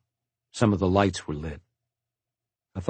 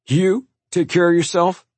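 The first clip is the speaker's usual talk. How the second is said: higher, louder incredulous jeer; taunting question, pitched up; mocking; 9 semitones higher, 5 dB louder than usual. A narrator's reading. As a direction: scoff at a brother in disbelief. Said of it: "George laughed at Walter".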